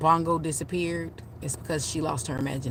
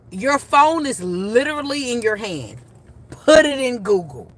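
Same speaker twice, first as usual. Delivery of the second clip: higher, louder, imitating her own exasperation; exasperated aunt; 6 semitones higher, 7 dB louder than usual. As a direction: retell it as an emphatic, fed-up retort. Exasperated aunt retold to niece.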